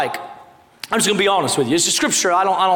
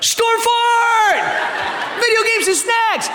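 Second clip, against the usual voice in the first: put-on excited kids shouting; 11 semitones higher, slower than usual. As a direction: shout it like a thrilled child. Kids excited about their storm fort.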